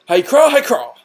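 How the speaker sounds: loud, high whooping cry of Hi-cra; hammed-up battle cry. Wrestler shopkeeper cheering at the player, loud. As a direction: belt out the wrestler's catchphrase.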